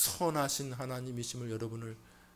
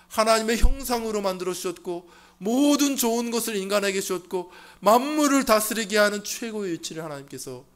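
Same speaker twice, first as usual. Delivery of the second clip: loud, high proclamation; full-force preaching; 10 semitones higher, 15 dB louder than usual. Acted. Pastor proclaiming God's gifts to the congregation, loud. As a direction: preach it out to the back row.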